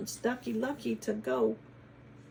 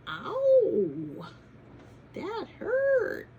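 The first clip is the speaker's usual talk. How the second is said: high, loud squealing ouch; sudden high pained hen voice; hurt hen cry; 11 semitones higher, 6 dB louder, much slower than usual. Acted. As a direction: yelp like a hen bonked on the head. Hen hit on the head.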